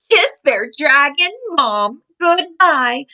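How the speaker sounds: ordinary delivery, nothing marked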